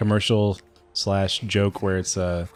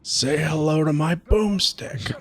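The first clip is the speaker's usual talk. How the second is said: slow, higher, swaggering hero one-liner; Ash impression; 8 semitones higher, much slower than usual. Quoting Ash from Evil Dead, slow swaggering one-liner. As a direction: savour the catchphrase slowly.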